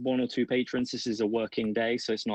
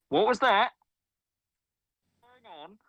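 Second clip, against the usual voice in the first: a lightly higher, distant call from offstage; kitchen voice calling; 11 semitones higher, 3 dB louder, slower than usual. Acted. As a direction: shout a puzzled question from the back room. Voice calls from the kitchen.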